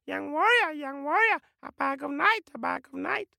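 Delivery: very high chattering bird; tiny high-pitched jungle bird calling out; squawking bird voice. Acted. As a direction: repeat it like a parrot. Watching birds chatter to the warrior.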